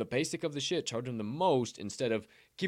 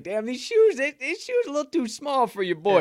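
higher, louder mock whine about too-small shoes; complaining kid; 12 semitones higher, 6 dB louder than usual. Growing boy to grandfather about tight shoes, high complaining. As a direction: act out a growing boy's loud complaint.

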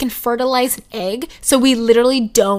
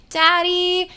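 higher sing-out call to dad; 7 semitones higher than usual. Retold or imitated. Daughter calls her dad from the bathroom, calling out. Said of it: "I just call him, like".

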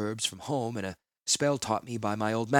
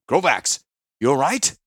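urgent call; 4 semitones higher, 10 dB louder than usual. Acted. Gerard calls to stunned Krovax.

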